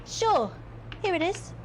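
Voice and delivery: mocking a doormat handing it over; mock pushover voice. Mocking the player's weak choices.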